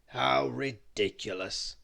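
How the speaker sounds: slow, grumpy character voice; Mister Grumble scoffing at the huge tent, slow and grumpy; grumbling. Acted. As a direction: grumble it out slowly in disgust. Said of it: "grumbled Mister Grumble".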